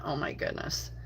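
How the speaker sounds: usual speaking voice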